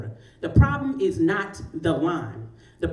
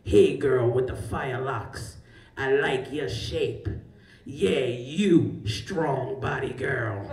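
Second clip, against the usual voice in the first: slow, lower, drawn-out pickup line; the stranger's drawn-out catcall, repeated; 4 semitones lower, much slower than usual. Retold or imitated. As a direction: lay the come-on on thick and slow. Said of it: "and the voice is like".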